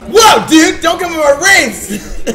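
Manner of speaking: high, loud shouted warning; mock-panicked bro yell; mock-offended bro. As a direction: shout it in hammed-up alarm, near laughing. Mock alarm in bro bit.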